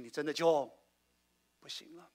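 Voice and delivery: normal talking voice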